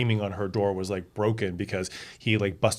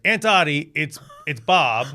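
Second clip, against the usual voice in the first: raised, higher calling voice, slowing; 5 semitones higher, 7 dB louder than usual. Retold or imitated. Cop cousin announces himself to aunt, mock calling out. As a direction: imitate calling to someone through a door.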